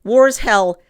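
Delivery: hammed-up shrug of an excuse; mock excuse. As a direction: parrot the lazy excuse with scorn. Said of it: "you don't get to just say".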